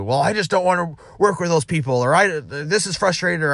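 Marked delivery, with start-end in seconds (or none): 0.0-1.9 higher-pitched imitation of a balky employee; mock stubborn complaint. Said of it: "those really stubborn ones". 2.1-3.4 pitched-up imitation of griping; mock stubborn complaint. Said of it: "those really stubborn ones".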